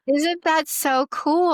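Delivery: high, slow and eager, marvelling; excited. Real, not acted. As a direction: share the wonder with your guests. Sharing moving testimonials, enthused.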